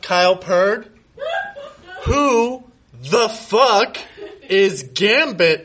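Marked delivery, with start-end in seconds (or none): 2.0-5.6 slow, stretched, high demand, breaking into a laugh; hammed-up indignant demand. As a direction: drag out each word like an outraged lover. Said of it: "I demanded".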